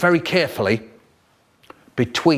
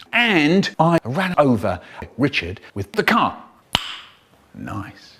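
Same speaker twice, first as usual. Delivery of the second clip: usual speaking voice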